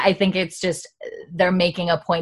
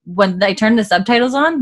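ordinary talk, nothing marked

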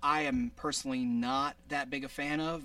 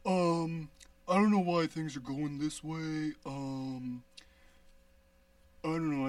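lightly voiced puzzled character; a hint of a dopey voice; another silly voice. Shown as a demonstration. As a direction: mumble as a confused cartoon figure. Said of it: "Oh, yeah, I do this one".

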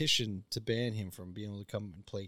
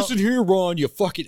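loud, higher furious commentator yell; angry yell; 8 semitones higher, 13 dB louder than usual. Retold or imitated. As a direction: yell at him like a furious hockey pundit. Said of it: "fucking Don Cherry just yelled".

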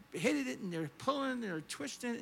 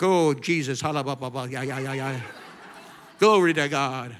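loud, low burst of babbled prayer; mock fervent praying; 7 semitones lower, 10 dB louder than usual. Preacher covering the man's curse, mock fervent praying. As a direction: drown him out with hammed-up praise. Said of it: "I'm like".

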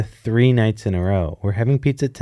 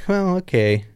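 awkward okay; 7 semitones higher than usual. Retold or imitated. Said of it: "And I'm like".

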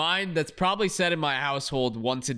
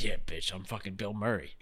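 low, quieter mock-tough line; imagined kid's retort; 7 semitones lower, 7 dB quieter than usual. Co-host voicing the kid facing Bill Murray, mock-cocky. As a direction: lower it and play cocky, half to yourself. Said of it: "that little kid is just like".

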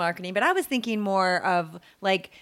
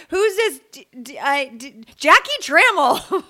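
high, loud mock demand, stumbling; indignant wife; 5 semitones higher, 7 dB louder, slower than usual. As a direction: imitate a wife struggling with an unknown name. Acting the wife stumbling over name.

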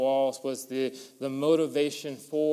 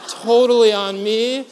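big high boast, much louder, played for laughs; hammed-up bragging to the guys, much higher and louder; mock grand gesture; 7 semitones higher, 11 dB louder than usual. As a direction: puff it up like a generous host.

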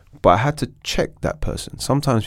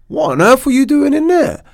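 much higher and louder, baffled; incredulous outburst; 8 dB louder than usual. Retold or imitated. Guest recalls his shock at the sweating actor, shouting high. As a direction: blurt it out in disbelief. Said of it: "I'm like".

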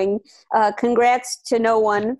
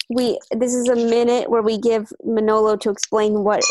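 usual speaking voice

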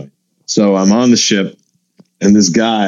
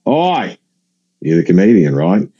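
mock Aussie, Oi pitched up; Australian accent; 4 semitones lower than usual. Australian passenger stopping a comedian, mock Australian accent. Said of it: "I apologize to my Australian listeners".

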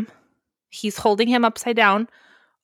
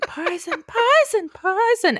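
high little chanting gnome voices; gnome chorus; 12 semitones higher, slower than usual. Retold or imitated. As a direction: echo it like a crowd of tiny gnomes. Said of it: "all the gnomes are like".

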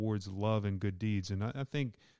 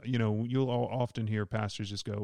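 ordinary talk

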